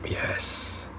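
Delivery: breathy whisper of delight; whispered yes. Real, not acted. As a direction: whisper it after a tasty bite. First bite of taco.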